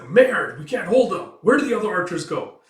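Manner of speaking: high, loud panicked cry; desperate shout. Acted. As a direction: yell that the gate won't hold. Defender desperate at gate.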